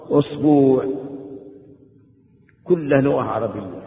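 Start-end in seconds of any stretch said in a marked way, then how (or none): 0.1-0.8 أسبوع as "usbuu'", u first, long u second; teacher's demo of أسبوع with a long final vowel; tenth variant form. Shown as a demonstration. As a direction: say أسبوع firmly as us-BOO'. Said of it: "العاشرة".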